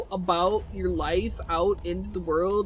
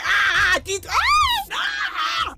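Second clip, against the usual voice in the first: high, louder startled cries; panicked yelps; 4 dB louder, slower than usual. Real, not acted. Caught off guard in the game, yelping in panic. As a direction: yelp in alarm.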